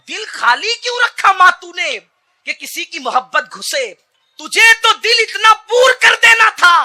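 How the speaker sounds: high, loud fiery sermon; preaching at full force. Acted. Preacher urging parents, roaring high and loud. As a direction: build the crowd with a roar.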